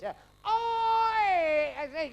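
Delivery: put-on long loud high drunken "Oi!"; drunk yelling. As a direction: bawl it across the cinema like a drunk. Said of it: "yelling this".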